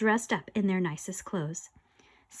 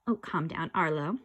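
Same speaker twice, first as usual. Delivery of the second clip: lower, softer calming; mock soothing; 6 semitones lower, 4 dB quieter than usual. Ghost calming panicked Arlo, low and soft. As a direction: settle him down coolly.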